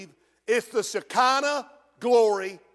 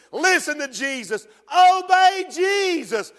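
full-force preaching; 6 semitones higher, 4 dB louder than usual. Acted. Preacher urging the church to hear Jesus, loud and high. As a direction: drive each line home to the congregation.

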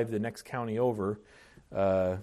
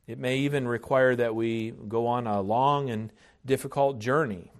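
normal talking voice

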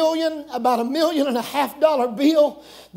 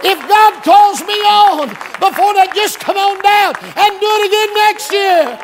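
high, loud, slow preacher's cry; raised pulpit delivery; full-force preaching; 6 semitones higher, 7 dB louder, slower than usual. Real, not acted. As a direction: preach it out with fire.

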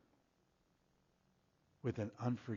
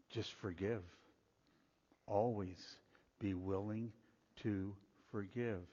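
gentle command; 4 semitones lower, 4 dB quieter than usual. Retold or imitated. Preacher voices Jesus' gentle command.

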